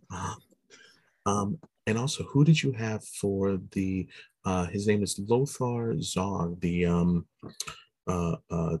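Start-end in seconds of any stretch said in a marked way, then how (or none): none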